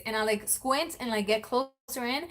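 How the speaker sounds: ordinary talk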